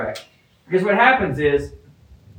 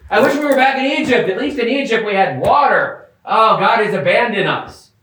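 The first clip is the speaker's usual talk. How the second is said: whiny complaint; 6 semitones higher than usual. Retold or imitated. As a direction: wail like a thirsty, spoiled crowd. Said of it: "and like".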